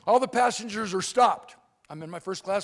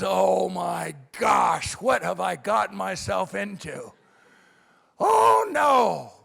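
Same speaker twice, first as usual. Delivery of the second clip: dismayed outcry; 3 semitones lower, slower than usual. Acted. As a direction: wail with regret, then yell the last words. Acted regret over taking office.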